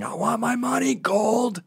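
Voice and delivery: high, strained mock scream; retold scream. Tenant demanding his deposit from landlord, contained mock scream. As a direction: imitate screaming up at someone, without full volume. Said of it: "I was on the street screaming".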